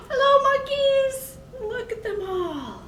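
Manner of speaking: high, gasping wonder; delighted greeting. Acted. Child greeting monkeys at the zoo, delighted. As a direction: greet them like a thrilled child.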